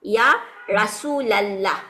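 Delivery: ইয়া রাসূলাল্লাহ, slow and drawn out with a clear la-ending; slow Arabic vocative. Shown as a demonstration. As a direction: say ইয়া রাসূলাল্লাহ slowly, stressing the ইয়া. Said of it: "রাসূলুল্লাহ কিন্তু হবে না". (ধীরে, স্পষ্ট করে ইয়া রাসূলাল্লাহ)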